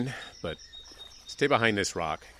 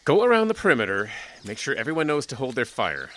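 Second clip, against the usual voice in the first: urgent order; 3 semitones higher, 8 dB louder than usual. Acted. Sheriff giving urgent orders.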